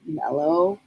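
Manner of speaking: normal talking voice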